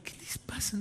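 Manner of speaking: puzzled whispered aside. Retold or imitated. Boy in the exam murmuring to himself, whispered. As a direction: breathe it under your breath, baffled.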